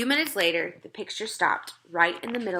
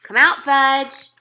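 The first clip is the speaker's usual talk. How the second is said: slow, higher, louder drawn-out call; calling a dog; 6 semitones higher, 9 dB louder, much slower than usual. A narrator's reading. Peter calls his lost brother like a dog. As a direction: coax him out like a dog. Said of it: "like I was calling a dog".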